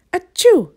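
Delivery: high sneeze at the end; loud sneeze. Acted. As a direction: sneeze loudly. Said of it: "Mister Sneeze".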